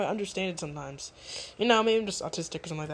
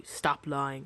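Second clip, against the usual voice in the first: mock cold accusation; 6 semitones lower, 4 dB quieter than usual. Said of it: "immediately said".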